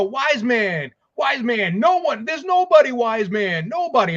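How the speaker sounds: Roman Reigns. Retold or imitated. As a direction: imitate a wrestler appealing to his wise man. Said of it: "and he's like".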